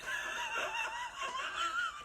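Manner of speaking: high, giggling burst of laughter; can't hold the laugh in. Real, not acted. Reader laughs at the tarot cards, high giggling burst.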